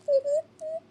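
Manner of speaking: sudden squeaky giggle at the joke; high burst of laughter. Real, not acted. Cracking up at a cheeky decal, high giggle. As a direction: break into a high-pitched laugh. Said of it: "I shouldn't be laughing".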